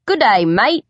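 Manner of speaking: hammed-up bad Australian accent, drawled; a cheerful, clumsy Australian drawl greeting the mirror; terrible Australian accent. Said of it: "I drawl in a terrible Australian accent".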